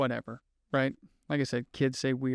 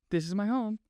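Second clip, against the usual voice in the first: higher, put-on British lilt; British accent; 7 semitones higher than usual. Imitating the little girl recognizing her home, in a British accent. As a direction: lift it into a mock British accent. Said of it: "She's British. And goes".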